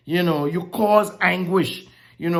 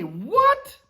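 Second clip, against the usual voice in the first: rising, higher disbelief; mock surprise; 7 semitones higher than usual. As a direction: act astonished. Incredulous aside repeated.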